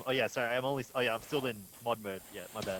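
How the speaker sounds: normal talking voice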